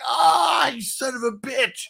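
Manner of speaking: high, loud wail then curse; hammed-up pained cursing; mock outraged yell. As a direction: imitate a helpless victim's outcry. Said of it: "he's like".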